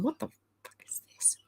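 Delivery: whispered disbelief, barely audible; baffled whisper. Retold or imitated. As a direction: mouth it in a voiceless whisper. Acting out baffled reaction while reading.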